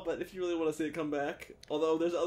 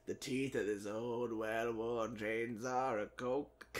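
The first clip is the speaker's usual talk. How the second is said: slow low narrator voice; 6 semitones lower, 6 dB quieter, slower than usual. Pretend. Dramatic game-narration parody.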